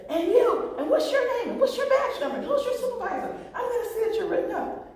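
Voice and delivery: high, hammed-up indignant rant, laughing through it; mock the pompous driver demanding names and badge numbers. Entitled driver berating the officer, hammed-up high indignation. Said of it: "the gentleman says".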